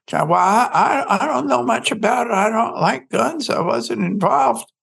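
a flustered wife laughing off the question; the wife quoted nervously as she denies it. Retold or imitated. Wife voiced, nervous denial.

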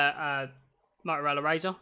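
ordinary delivery

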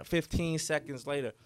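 ordinary talk, nothing marked

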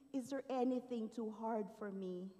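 ordinary talk

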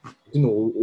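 normal talking voice

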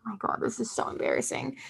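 hushed, breathy aside after a sigh; sigh, then mutter it in embarrassment; embarrassed confession. Real, not acted. Embarrassed confession about own music.

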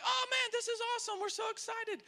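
high, rapid mock thrill; excited pastor. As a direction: burst out in a high, quick rush of excitement. Pastor's excited reply retold.

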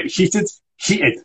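heated said crisply with a falling end; model pronunciation. Shown as a demonstration. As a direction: demonstrate heated clearly for the student. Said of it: "very good".

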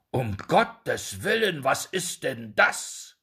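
slow, loud cry of horror; horrified cry. A narrator's reading. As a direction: gasp it out in shock, slowly. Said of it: "rief mit Entsetzen". (entsetzter Ausruf)

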